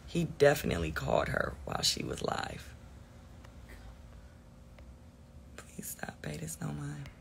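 hushed whisper under the breath; whispered aside. Real, not acted. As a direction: whisper it so the stream barely hears. Aside reading comments under breath.

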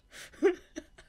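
bright high burst of laughter. Real, not acted. Reader cracking up at her pun, high laugh. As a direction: laugh out loud at your own joke.

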